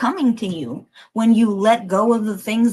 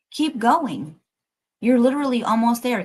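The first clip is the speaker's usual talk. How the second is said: ordinary talk, nothing marked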